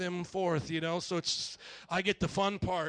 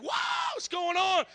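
startled; 10 semitones higher than usual. Retold or imitated. Startled reaction to sudden sickness.

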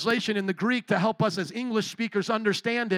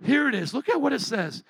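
ordinary talk

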